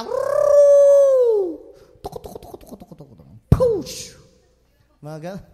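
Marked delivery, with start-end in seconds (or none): 0.0-1.7 very high, loud whoop; shout. Acted. Army shouting with the trumpets, wild whoop. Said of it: "sumigaw sila ng malakas". 2.0-3.3 soft mock trumpet toots; imitated trumpet. Preacher mimicking the trumpets, soft toots. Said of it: "Sabayan ng mga trumpeta". 3.5-4.2 high, loud push cry; shout. Acted. Army charging the wall, loud high cry. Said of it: "sumigaw sila ng malakas".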